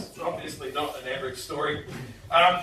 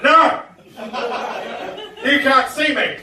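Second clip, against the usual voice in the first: put-on loud, higher childish shout; drunk denial; 7 semitones higher, 9 dB louder than usual. Drunk student behind the fence shouting at the cop, loud and high. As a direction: yell it like a kid sure he's hidden. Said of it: "I'm like".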